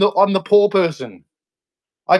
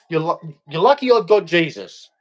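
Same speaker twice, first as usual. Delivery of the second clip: usual speaking voice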